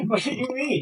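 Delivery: high, quiet laugh through the words; cracking up mid-question. Real, not acted. Friend cracks up asking what he means, laughing.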